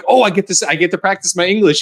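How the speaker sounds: high-pitched, eager imitation of locals; excited. Germans meeting an American, hammed-up excited. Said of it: "they were so excited to hear".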